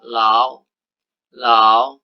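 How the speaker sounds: "lau" said with a rising second tone; Mandarin second tone. Shown as a demonstration. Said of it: "nada lau itu menjadi nada kedua".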